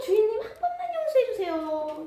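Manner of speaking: high, strained begging; servant's first cry to the master; first plea. Acted.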